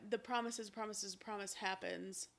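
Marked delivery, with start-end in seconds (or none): none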